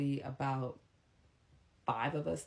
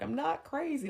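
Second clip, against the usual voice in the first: mock whiny denial; 12 semitones higher than usual. Voicing him high.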